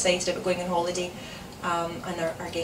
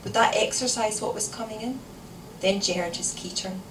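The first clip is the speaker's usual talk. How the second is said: plain reading voice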